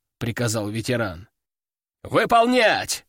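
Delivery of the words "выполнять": barked order. Acted. Veteran ordering the soldiers to move, barked. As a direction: bark it like a drill sergeant. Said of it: "рыкнул он".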